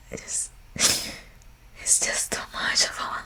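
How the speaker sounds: real tearful whisper; overwhelmed. Real, not acted. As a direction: voice gone to a faint whisper. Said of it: "It's overwhelming".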